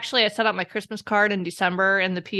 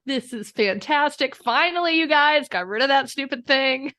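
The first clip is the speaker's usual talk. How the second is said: higher-pitched, gleeful mock cat voice; gloating cat; 6 semitones higher than usual. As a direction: crow with relief as the cat.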